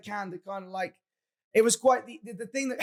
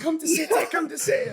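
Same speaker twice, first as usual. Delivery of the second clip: higher mock chant of fans on the mic; imitating fans shouting into mics; mock crowd shout; 9 semitones higher, 4 dB louder than usual. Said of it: "they were shouting it on the mic".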